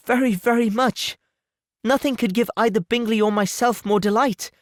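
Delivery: congratulate, then ask gently; tender anxious Jane. Acted. Jane delighted yet anxious.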